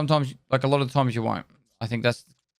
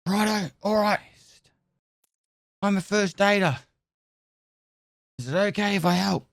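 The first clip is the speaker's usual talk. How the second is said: higher, eager mock medic; medic asking to help; 7 semitones higher than usual. Trainee medic to patient, higher eager offer. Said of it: "The snake has left the area".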